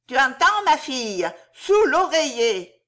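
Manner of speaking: put-on high, loud old witch pressing the point; a sorceress hammering home her order; high cackly crone, emphatic; old witch insisting.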